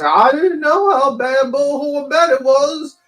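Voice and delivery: high, slow wailing mock innocence; mock ignorance. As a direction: whine it out loud like a fake-clueless supporter. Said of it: "you're gonna play ignorance".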